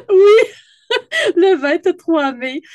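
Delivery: high, loud laughing confirmation; real laughter. Real, not acted. Host confirming the workshop date to her guest, laughing. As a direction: laugh through the words, drawing out the date.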